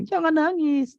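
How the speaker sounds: very high-pitched, loud imitation of a child; meniru anak. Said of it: "Nanti anak saya bilang". (menirukan suara anak yang melengking keras)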